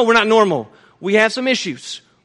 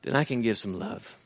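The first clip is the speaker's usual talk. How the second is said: hushed sermon; 10 semitones lower, 6 dB quieter than usual. Acted. Preacher speaking tenderly to the brethren, soft and low. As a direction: bring it down to a near-hush. Preacher drops to intimate reflection.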